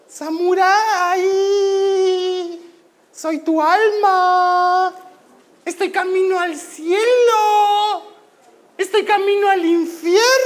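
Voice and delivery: put-on high, drawn-out little-girl soul voice; voz de niña. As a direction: call out eerily in a high child's voice, stretching the vowels. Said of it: "¿Por qué mi alma tiene voz de niña?". (voz de niña, aguda y alargada)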